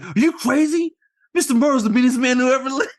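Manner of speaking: put-on high, loud, fast kid's panic; panicked kid. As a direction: rattle it off like a terrified boy. Kids panicking about mean neighbor.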